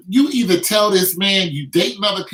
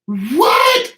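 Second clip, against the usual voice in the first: loud, high, startled yell; stunned shout; 12 semitones higher, 5 dB louder than usual. Retold or imitated. Retelling a fight, yelling in shock. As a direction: shout it out, dazed mid-fight.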